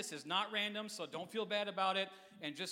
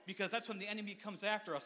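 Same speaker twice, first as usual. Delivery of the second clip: usual speaking voice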